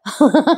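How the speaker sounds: light chuckle at the scene; quick little giggle; amused laugh. Real, not acted. Amused by the character's reply.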